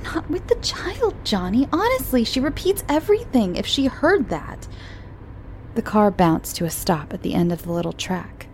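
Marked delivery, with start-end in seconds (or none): 0.0-4.7 high, fretful mother's voice; a prim mother hushing her lover; mother protesting to Johnny, high and fretful; fussy mother. Acted. Mummy's voice, high.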